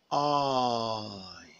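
very long "I", gliding slowly from open to close; stretched I diphthong. Shown as a demonstration. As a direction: hold "I" out, sliding gently down in pitch. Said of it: "It is a long vowel sound".

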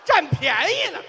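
loud, high outburst; high, full-voiced heckle; jeering shout. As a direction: shout it as a teasing accusation. Jeering at partner's remark.

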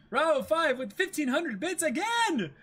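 high, loud shout of amazed thanks; overwhelmed, shouting with delight and a laugh; thrilled surprise. Real, not acted. Streamer gushing at a repeat donor's bits, delighted shout.

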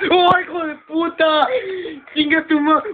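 high, loud strangled cursing; real fright yell; scared shout. Real, not acted. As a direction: scream it as you get caught. Jump scare, screaming curses.

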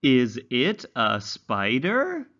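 slow, high, sing-song storybook reading; reading to children. Acted. Teacher reading a simple story to young English learners, slow and sing-song. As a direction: read it slowly like a picture book for little ones.

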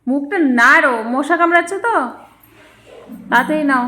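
mother loudly urging her son to chew; scolding call. Real, not acted. Mother scolding slow-eating child.

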